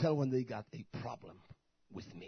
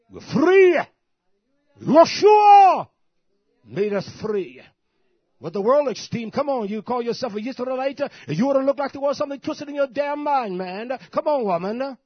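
roaring, much higher and louder preacher; preaching at full force; 11 semitones higher, 15 dB louder than usual. Real, not acted. Preacher roaring freedom at his congregation, full force. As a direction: shout it out to the church.

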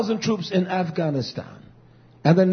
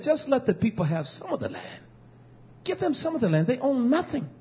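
a soft, higher plea; quoting Mugabe pleading with the British, softly; imitated pleading; 6 semitones higher, 4 dB quieter than usual. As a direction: gently beg for the land. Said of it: "he begged, he pled".

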